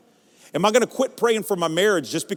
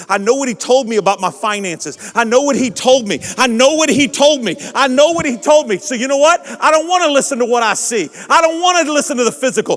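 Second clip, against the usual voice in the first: louder and higher with each "told"; building preaching; 7 semitones higher, 7 dB louder than usual. Acted. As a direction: climb into a loud, high preach.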